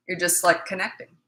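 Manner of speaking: ordinary delivery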